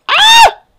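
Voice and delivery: short, piercing high shriek; startled scream. Real, not acted. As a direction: let out a sudden high scream. Startled in a hiding game.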